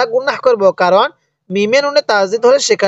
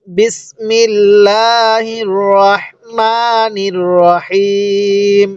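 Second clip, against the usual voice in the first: slow, measured tajweed chant with long held vowels; Quran recitation; much slower than usual. Shown as a demonstration. Teacher reciting the sura for learners to hear, slow tajweed chant. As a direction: recite slowly, stretching vowels and nasal sounds. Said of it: "একবার revision দিব".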